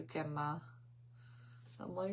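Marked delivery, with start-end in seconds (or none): 0.0-0.6 Grandma as a soft "Gemma", rising at the end; toddler pronunciation. Retold or imitated. Grandmother repeating her grandson's words, toddler pronunciation. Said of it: "It's the way he says it".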